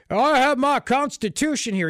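put-on dopey Southern accent. Host mimics a TV pundit mocking Constitution carriers, dopey Southern accent. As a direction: put on a dumb trailer-park Southern accent. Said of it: "He does this dopey, dumb, I don't know if it's a Southern accent".

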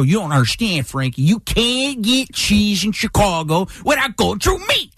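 hammed-up angry mafioso uncle, pitch climbing; mock-agitated rant, stretching out the words; imitating worked-up uncle. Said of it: "He'd just get so worked up".

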